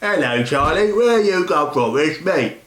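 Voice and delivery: a light, slowed Cockney accent imitation; slow half-hearted Cockney accent; Hugh Grant's Cockney. Reviewer imitating a Cockney film character, lightly. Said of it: "He does this kind of accent in it".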